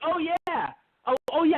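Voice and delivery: high-pitched mock realization; sudden recognition. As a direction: imitate people finally catching on. Acts out others suddenly recognizing the school.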